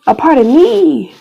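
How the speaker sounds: high voice welling up with grief; real anguish. Real, not acted. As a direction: let grief push the voice up, word by word. Breaking down over the abortion.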